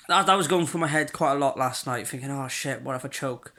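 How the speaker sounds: ordinary delivery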